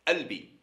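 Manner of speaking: Albi said the Tlemcen way, with the q dropped; Tlemcen accent. Shown as a demonstration. Teacher imitating Tlemcen speakers, Tlemcen accent. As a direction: drop the q, as they do in Tlemcen. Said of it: "ils disent a".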